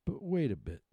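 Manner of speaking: high, loud little oyster cry; squeaky high oyster protest. Acted. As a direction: call out in alarm as small oysters. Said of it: "the oysters cried".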